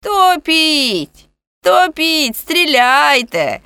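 high, loud defiant cries; ringing voice. A narrator's reading. Man in the well daring those above, high ringing shouts. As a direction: call up each word, long and ringing. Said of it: "лунав голос Гієрмо".

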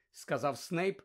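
plain reading voice

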